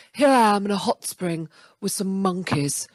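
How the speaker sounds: posh travel-show presenter voice; Joanna Lumley. Acted. Comic imagining her own travel show, posh presenter voice. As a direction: put on a plummy English celebrity accent. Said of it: "and had this voice".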